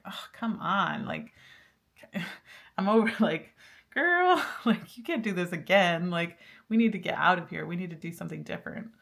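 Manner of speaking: impatient friend yelling at you to move on; mock frustrated rant with a laugh; fed-up outburst. Spirit voiced as fed-up, pushing.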